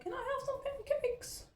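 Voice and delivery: put-on tiny, very high child voice; squeaky little mock pleading; imitating her boys. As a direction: mimic pestering kids, high and soft. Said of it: "It's like".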